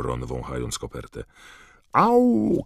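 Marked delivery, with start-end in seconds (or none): 1.9-2.6 high, loud cry of pain; sudden "ow" shriek, drawn out; pained yelp. A narrator's reading. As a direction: yelp as if scalded. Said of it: "krzyknęła Hermiona ze łzami w oczach".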